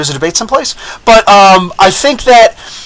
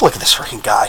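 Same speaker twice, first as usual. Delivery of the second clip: scornful aside; 9 semitones lower, 8 dB quieter than usual. Retold or imitated. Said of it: "I'm like".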